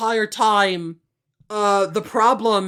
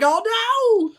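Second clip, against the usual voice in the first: very high comic cry; mock dismay; 12 semitones higher than usual. Reacting for the family to the smashed teacup, mock dismay. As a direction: squeal it in hammed-up horror.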